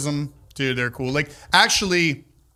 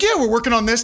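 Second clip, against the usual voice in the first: higher, put-on nonchalance; super casual; 7 semitones higher, 3 dB louder than usual. Owner explains the empty building to police, acting super casual. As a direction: casually brush off suspicion. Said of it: "about it, casual".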